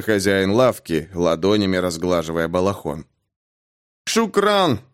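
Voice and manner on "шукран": higher, nasal word of thanks. A narrator's reading. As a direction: say it through a bloodied, stuffed nose. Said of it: "гнусавя разбитым в кровь носом, пробормотал Дэнни". (гнусаво, разбитым носом)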